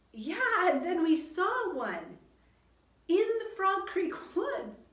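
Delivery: put-on high, loud, slow young-boy excitement; high, loud boyish wonder, drawn out; excited Jack. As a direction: recall it eagerly as a boy. Reader voices Jack.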